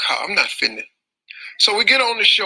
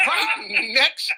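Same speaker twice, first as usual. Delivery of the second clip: laughing while talking; telling the punchline through laughter; 7 semitones higher, slower than usual. Real, not acted. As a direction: crack up as you say it.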